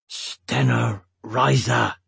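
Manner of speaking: slow growl through frustration; low growl. A narrator's reading. Roran frustrated at a stone that won't budge, growling. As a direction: growl the words out slowly under your breath. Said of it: "he growled under his breath".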